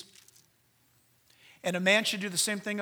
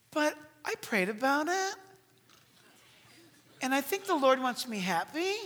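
hesitant, sing-song excuses with rising ends; self-justifying believer; 2 semitones higher than usual. Acted. Married churchgoer justifies an affair, coyly self-excusing. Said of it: "You will say".

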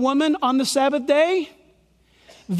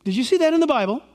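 usual speaking voice

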